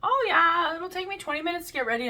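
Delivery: imitating the boyfriend's breezy promise; mocking boyfriend. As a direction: toss it off like a careless boyfriend.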